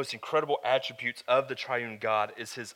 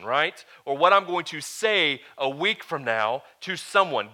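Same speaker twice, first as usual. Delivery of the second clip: loud, raised-pitch preaching with heavy stresses; emphatic preaching; 5 semitones higher, 9 dB louder than usual. Real, not acted. Preacher assuring the congregation that God knows everything, loud emphatic preaching. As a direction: drive each point home from the pulpit. Preacher building on God's omniscience.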